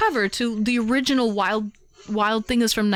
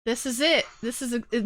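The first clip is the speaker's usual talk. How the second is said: normal talking voice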